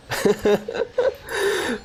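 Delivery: a burst of high giggling; high delighted laugh. Real, not acted. Player laughing high at a lucky gacha pull.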